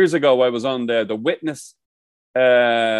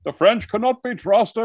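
slow, higher-pitched impression of a grand film actor; Ian McKellen; 9 semitones higher, slower than usual. Podcaster quoting a Da Vinci Code actor, slow impression. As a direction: draw it out high, imitating the actor. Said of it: "your man from the Da Vinci Code".

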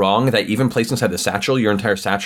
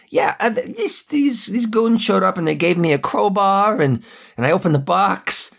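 much higher, pleading, nervous henchman; nervous goon; 11 semitones higher than usual. Acted. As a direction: beg the boss not to be angry, voice up high. Goon explains to Petrov.

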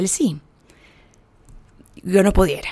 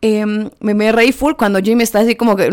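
usual speaking voice

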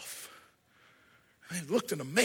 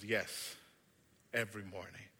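low, soft reassurance; calm him, promise it; gentle maker reply; 10 semitones lower, 13 dB quieter than usual. Acted. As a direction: wicker maker's quiet yes. Maker confirms softly.

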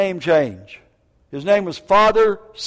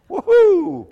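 much higher, louder woohoo; joyful whoop; 12 semitones higher, 6 dB louder than usual. Real, not acted. Preacher celebrates the pardon from the King, loud whoop. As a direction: whoop for joy.

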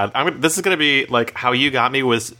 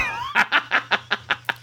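burst of laughter; 4 dB quieter than usual. Real, not acted. Laughing at own joke.

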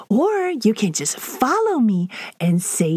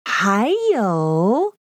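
normal talking voice

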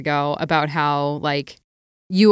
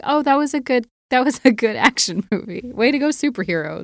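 flat unimpressed; 6 semitones higher than usual. Retold or imitated. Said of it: "I was like".

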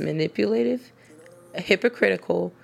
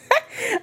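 a sudden high laugh at her own joke; high burst of laughter. Real, not acted. Laughing at her own criminal games joke, high burst. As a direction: crack up at yourself. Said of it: "I'm cracking myself up".

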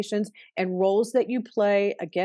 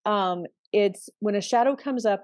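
ordinary talk

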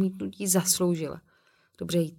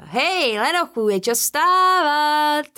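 mocking yell; 10 semitones higher, 7 dB louder, much slower than usual. Tom mocking the sleeper, yelling.

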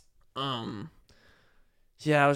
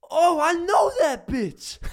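very high, loud, drawn-out holler; mock high-pitched whooping yell; imitated shout; 8 dB louder, much slower than usual. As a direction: mimic rowdy friends yelling out slowly. Imitating friends shouting at her.

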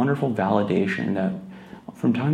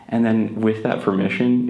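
ordinary talk

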